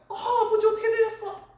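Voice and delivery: soap-opera grief laughed through; hammed-up wailing son. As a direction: ham up the heir who flew home for the inheritance. Mock heir rushing home from abroad.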